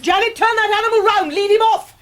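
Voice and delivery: high, loud commands across the garden; calling out orders. Acted. Aunt calling Janet to lead the donkey away, loud high orders. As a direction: shout orders at the maid from afar.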